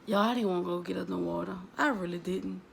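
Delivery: normal talking voice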